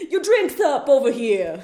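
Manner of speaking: loud hammed-up New York accent. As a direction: call out like a New York barista. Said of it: "that's how all New York people sound".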